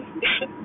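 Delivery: quiet, voiceless chuckle; small breath of a laugh; soft laugh. Real, not acted. Vlogger amused by her own boast, soft chuckle.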